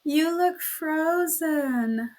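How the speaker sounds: mock her sweetly; slow saccharine tease; sugary mocking. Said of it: "her sugary smile".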